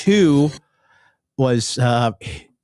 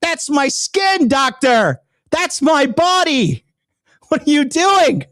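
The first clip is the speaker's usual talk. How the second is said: much higher, slow, hammed-up panic at the doctor; mock alarmed protest; slower than usual. Watching his surgery footage, protesting to the doctor in mock horror. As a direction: squeal in fake horror at what the doctor does. Voicing himself at surgery video.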